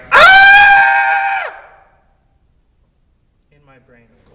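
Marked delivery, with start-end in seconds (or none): 0.1-1.5 huge high shriek, very loud; imitated shriek of shock; inner scream. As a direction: scream in horror at the blood. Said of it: "and I'm like".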